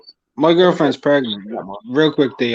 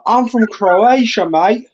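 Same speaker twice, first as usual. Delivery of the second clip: mock Kiwi-Aussie accent, higher and slow; New Zealand accent; 8 semitones higher, 4 dB louder, much slower than usual. Mocking New Zealand Croatians in a Kiwi accent. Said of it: "fucking being like".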